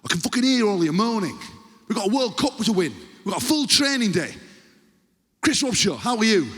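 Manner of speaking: high, hammed-up angry coach; harsh. Coach confronting his moaning squad, harshly. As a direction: demand it in harsh northern English. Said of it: "it's always just so harsh".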